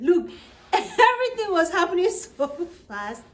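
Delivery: high, loud exclamation through laughter; laughing. Real, not acted. Telling viewers how fast it all happened, laughing. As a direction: laugh while marvelling at the chaos.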